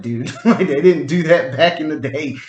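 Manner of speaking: amused protest through laughter; laughing while talking. Real, not acted. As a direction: crack up as you make the point. Joking retort, cracking up while talking.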